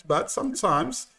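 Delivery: ordinary delivery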